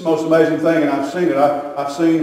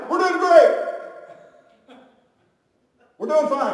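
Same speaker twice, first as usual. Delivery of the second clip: high, squeezed, drawn-out reassurance; mock brave face; 10 semitones higher, 4 dB quieter, much slower than usual. Husband with crushed hand reassuring visitors, high pained pretence. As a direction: insist all is well through crushed-hand pain.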